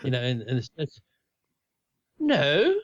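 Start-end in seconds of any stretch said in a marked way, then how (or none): 2.2-2.8 very high, loud imitated brush-off; mock dismissive retort. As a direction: act out shooing someone off, high-pitched. Retold brush-off of the fella.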